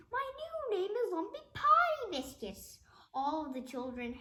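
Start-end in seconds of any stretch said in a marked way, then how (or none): 0.1-2.8 high, loud despairing whine; comic despair. Acted. Principal wailing his new silly name. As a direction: wail it like a ruined man.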